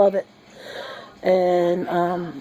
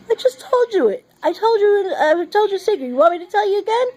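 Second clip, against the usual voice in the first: mock little girl; 11 semitones higher than usual. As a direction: play the cross granddaughter repeating herself. Granddaughter annoyed at grandma.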